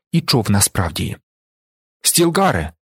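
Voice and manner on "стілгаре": raised call, falling steeply at the end. A narrator's reading. Paul hails his approaching friend, calling out. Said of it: "гукнув Пол".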